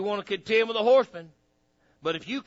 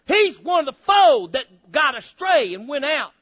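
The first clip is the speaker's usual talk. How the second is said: loud, high fiery preaching; 7 semitones higher, 7 dB louder than usual. Acted. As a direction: preach it hard to the congregation. Preacher rising to full force.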